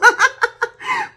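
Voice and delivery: very high, loud burst of laughter; a full real laugh. Real, not acted. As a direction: crack up at yourself. Laughing at her own cocoa craving.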